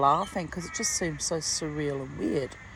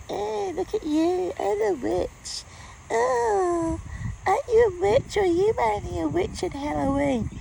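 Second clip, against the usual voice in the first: mocking neighbours; 14 semitones higher, 4 dB louder than usual. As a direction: squeal it like a jeering busybody. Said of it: "people in my neighbourhood who are like".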